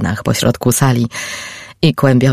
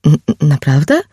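surprised. Acted. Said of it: "Zdziwiłam się".

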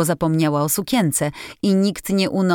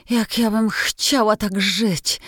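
slow, longing cry, higher; wistful exclamation; 5 semitones higher, slower than usual. A narrator's reading. Jo dreaming of Laurie's school life, longing exclamation. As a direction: sigh it out with longing. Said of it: "zawołała Jo".

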